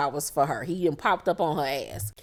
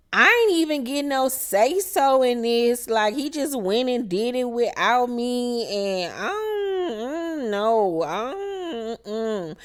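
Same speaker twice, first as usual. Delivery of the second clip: high, broken, fake-tearful voice; mock crying Sierra; 3 semitones higher, slower than usual. Sierra whining in her confessional, mock crying and high. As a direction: ham up the sob in the confessional.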